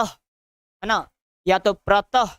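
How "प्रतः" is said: प्रतः with an audible final h; visarga pronunciation. Shown as a demonstration. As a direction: say प्रतः as "pratah". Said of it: "ह के समान होता है".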